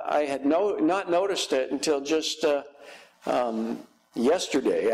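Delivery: normal talking voice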